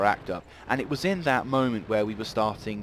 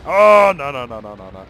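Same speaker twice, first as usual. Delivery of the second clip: alarmed cry; 3 semitones higher, 13 dB louder than usual. Real, not acted. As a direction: panic as the shot comes. Enemy tank about to hit.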